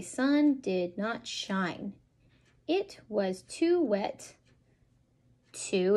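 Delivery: slow, lilting picture-book read; reading to children. Shown as a demonstration. Parent reading a counting book to a toddler, slow and sing-song. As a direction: draw the little listener into each page.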